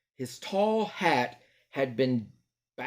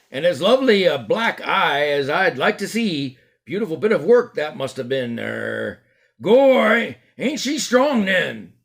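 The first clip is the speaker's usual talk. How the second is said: louder, higher gleeful bystander; admiring onlooker; 5 semitones higher, 7 dB louder than usual. Acted.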